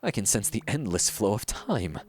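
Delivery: theatrical, self-important painter with sweeping pitch swings; pompous art master. Acted. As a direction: declaim like a grandiose mentor to a disciple. Reads a pompous painter's game dialogue.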